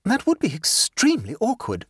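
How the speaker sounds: a hint of a pleased, scatterbrained aunt; light, self-satisfied chatter; gaily. A narrator's reading. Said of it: "said Jane".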